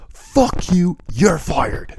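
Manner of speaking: high, loud firing outburst; mock angry yell. Boss firing the developer on the spot, yelling. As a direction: snap at him and fire him. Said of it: "And he goes".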